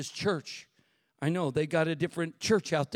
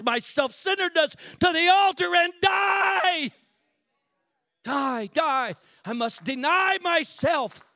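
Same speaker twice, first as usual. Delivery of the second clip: loud, high pleading prayer; fervent prayer; 9 semitones higher, 5 dB louder than usual. Retold or imitated. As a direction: cry out to God from the altar. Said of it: "stop preaching and say".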